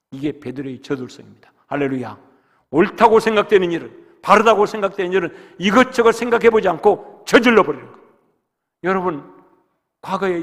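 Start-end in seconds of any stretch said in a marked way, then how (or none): 2.7-8.0 loud, driving sermon cry; forceful preaching. Acted. Pastor urging the congregation, loud preaching.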